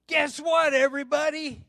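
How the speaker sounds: high, loud mock announcement to a crowd; calling out. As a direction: call the room to attention, acting her out. Acting announcement to congregation.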